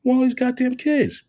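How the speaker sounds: high, mock outraged ribbing; high teasing outrage at the ex-wife; incredulous teasing. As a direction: tease her in disbelief. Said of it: "I said".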